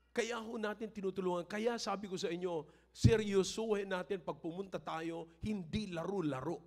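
high, loud preaching that swells to a shout; preaching at full force. Acted. Pastor to the congregation about money, preaching at full force. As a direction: drive it home like a fired-up pastor.

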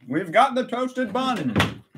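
high, drawn-out announcement; playful fanfare. Pretend. Streamer announcing the toasted bun's arrival, playful high fanfare. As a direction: herald it slowly in a high voice.

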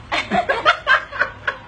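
high, breathy laughing fit; real wheezing giggles; dying of laughter. Real, not acted. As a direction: collapse into helpless laughter. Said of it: "cái này tôi chết mất".

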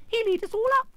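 very high, loud squeal of alarm; alarmed mouse queen. Acted. Mouse queen fearing the lion, alarmed squeal.

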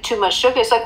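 usual speaking voice

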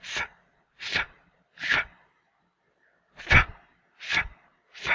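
ف sounded alone like Arabic fa; letter sound demo. Shown as a demonstration. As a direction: isolate the sound ف. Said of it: "شبيه بنطق حرف الف".

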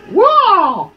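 high, loud startled whoa. Real, not acted. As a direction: gasp it in shock. Sudden surprise in game.

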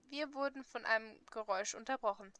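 usual speaking voice

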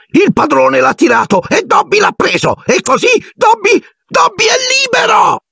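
very high elf voice, climbing to a cry; Dobby, jubilant. A narrator's reading. Elf realises he is free of his master, jubilant. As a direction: build squeaky excitement to a triumphant shriek.

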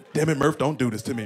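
normal talking voice

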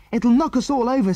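high, frightened exclamation; afraid. A narrator's reading. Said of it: "said Mary, afraid".

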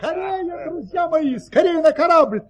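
high, urgent, hurrying everyone on; urgent call. Acted. Baron hurrying his friends onto the ship, high and urgent. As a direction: rush them aboard, pitch up. Baron urges servants to flee.

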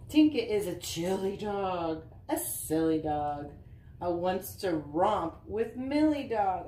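bouncy sing-song picture-book reading; reading to children. Acted. Mom reading a dog book to Easton, sing-song read-aloud. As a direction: read the rhymes with a lilt for little kids.

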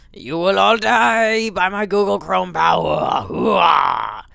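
booming battle shout; loud gung-ho soldier. Pretend. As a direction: bellow it like a warlord. Villain boasting of Chrome power.

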